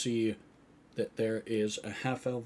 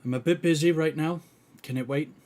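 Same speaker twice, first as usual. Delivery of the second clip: lightly voiced busy healer, higher and louder; a quick, raised brush-off from a busy healer; 6 semitones higher, 9 dB louder, faster than usual. Game master answers as busy healer.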